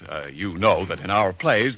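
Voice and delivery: ordinary talk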